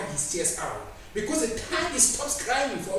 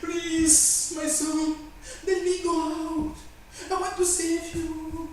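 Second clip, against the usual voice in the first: higher, slowed plea of a grieving spirit; pleading Holy Spirit; 8 semitones higher, much slower than usual. Acted. Holy Spirit pleading with the sinner to be let out, mild plea. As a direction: beg gently, a mild hint of tears. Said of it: "the Holy Spirit is crying in your life".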